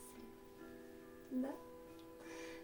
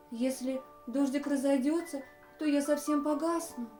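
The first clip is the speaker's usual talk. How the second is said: a campfire's anxious, rising plea; frightened campfire; 3 semitones higher, 7 dB louder than usual. Acted. Frightened campfire fretting that the rain will put it out, scared. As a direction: voice raised in worry, louder. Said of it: "очень испугался".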